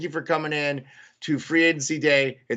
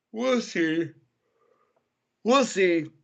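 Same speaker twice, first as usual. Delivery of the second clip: talking through a yawn; words stretched by a yawn; 5 semitones higher, slower than usual. Real, not acted. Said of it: "Sorry, I keep yawning".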